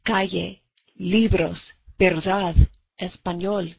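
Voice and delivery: calle, libros, verdad, español said with Spanish sounds, stress on the natural syllable; Spanish pronunciation. Shown as a demonstration. Teacher models calle, libros, verdad, español for students in Spanish pronunciation. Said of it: "Words like".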